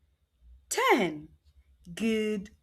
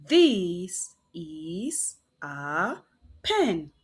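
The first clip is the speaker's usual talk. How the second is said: very slow whispered This is a; slow whisper reading; 6 semitones lower, much slower than usual. Shown as a demonstration. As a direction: draw each word out. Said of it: "read the sentence together".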